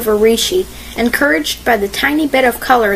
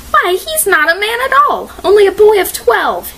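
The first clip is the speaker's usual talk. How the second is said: high-pitched indignant exclamation; crying out; 10 semitones higher than usual. A narrator's reading. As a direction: cry out in protest, pitched well up. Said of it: "Nancy cried".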